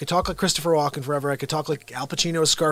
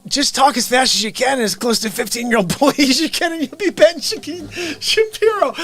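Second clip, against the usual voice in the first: high and louder, breaking into laughter; laughing while quoting; 10 semitones higher, 4 dB louder than usual. Real, not acted. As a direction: quote the advice through real laughter. Retelling friend's advice while laughing.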